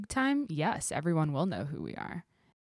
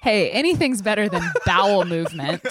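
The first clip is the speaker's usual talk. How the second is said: laughing through the words; giggling as she speaks; 3 semitones higher, 8 dB louder than usual. Real, not acted. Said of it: "laughing while saying it".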